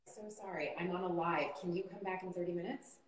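quiet apology to an interrupting caller; hushed phone aside. Real, not acted. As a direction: duck away and murmur into the phone. Answering phone call mid-live.

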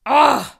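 loud disgusted groan; disgusted ugh. Acted. Disgust at David.

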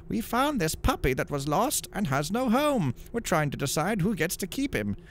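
put-on boy's voice. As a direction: voice a young boy. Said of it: "One boy responded".

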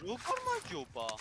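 high, drawn-out disbelieving question; real disbelief. Real, not acted. Player just beaten asks how, high-pitched disbelief.

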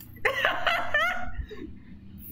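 high airy wheeze of laughter; breathy laugh. Real, not acted. Player noticing everyone stare, breathy laugh. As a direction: laugh in disbelief.

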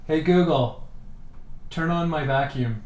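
calling the command again to the device; repeating to voice assistant. Real, not acted. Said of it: "Now let's try that again".

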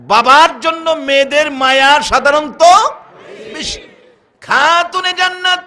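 impassioned preacher's proclamation; full-force preaching. Real, not acted. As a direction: press the crowd with high, loud phrases.

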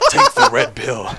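high laughing through the words; cracking up mid-line. Real, not acted. Laughing at friend's joke.

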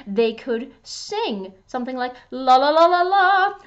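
ordinary delivery

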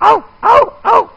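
high, thin puppy whimper; whimpering dog. Shown as a demonstration. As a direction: whimper like a timid little dog. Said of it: "a dog that says".

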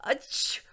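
explosive dragon sneeze; acted sneeze. A narrator's reading. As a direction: sneeze it out in a burst. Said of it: "having an allergy attack".

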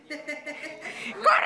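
drawn-out, panicky game yell; urgent cry. Real, not acted. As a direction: scream it to a teammate in danger. Urging teammate to run in game.

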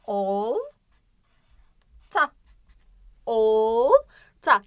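"Olta" with long "ool", pause, short "ta"; stretched initial O. Shown as a demonstration. Teacher stressing the start for pupils, long o in "Olta". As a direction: hold the first sound of each "Olta". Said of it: "Çok fazla başında vurguluyorum".

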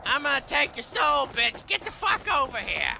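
high loud taunting battle cry. Real, not acted. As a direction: hurl it at the dragon. Charging the dragon.

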